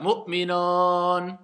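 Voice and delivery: Mu'minon with ou slackened into a long o; prononciation fautive. Shown as a demonstration. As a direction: hold a wrong o ending Mu'minon. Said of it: "Est-ce que ça, c'est un ou?". (prononciation fautive, Mu'minon avec un o traîné)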